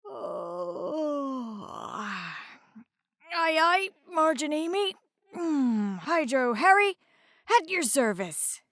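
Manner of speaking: yawning through the first words then a sudden perky lift; just woken, groggy then snapping to attention; sleepy yawning reply. A narrator's reading. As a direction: hydrocopter answering the lighthouse half asleep. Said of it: "He stretched and yawned before he answered".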